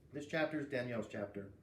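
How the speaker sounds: ordinary delivery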